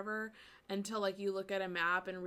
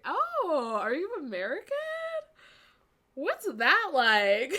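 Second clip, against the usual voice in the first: higher, drawn-out amazed question; curious foreigner voice; 7 semitones higher, 10 dB louder, slower than usual. Retold or imitated. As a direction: play the fascinated local asking about America. Retold question from foreign locals.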